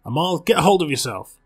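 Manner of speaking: loud, high call to snap him out of panic; sharp command. Acted. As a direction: bark it at him to pull him together. Grabs terrified bartender by shoulders.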